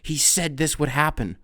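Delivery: ordinary delivery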